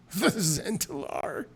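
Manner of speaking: scoffing high question, slow; derisive. Acted. Drunk Wendell mocks the city guard, slow and scoffing. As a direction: scoff at the very idea. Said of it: "He laughs derisively".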